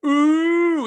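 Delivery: high, loud, held "ooh"; teasing class "ooh". Retold or imitated. Classmates reacting to the crush, teasing "ooh". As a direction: imitate a whole class teasing in one long hoot. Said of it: "And everybody went".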